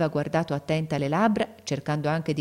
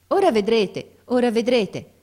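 higher, louder burst; eager reassurance; 6 semitones higher, 6 dB louder than usual. A narrator's reading. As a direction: promise it eagerly, voice lifted. Eager father nearly there, narrator hurrying.

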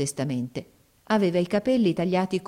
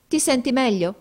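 put-on girl's voice; 7 semitones higher, 4 dB louder than usual. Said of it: "domandò la ragazza".

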